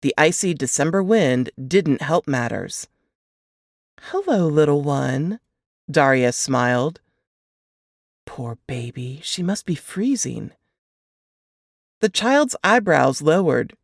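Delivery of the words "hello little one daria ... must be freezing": put-on tender to a toddler. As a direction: soothe a frightened little girl. Cop talks to crying toddler.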